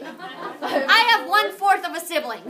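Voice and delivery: laughing through the line, loud. Real, not acted. Teacher laughing at a student's mistake. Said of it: "What did they just tell me?".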